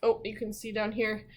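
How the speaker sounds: plain reading voice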